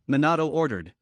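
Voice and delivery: ordinary delivery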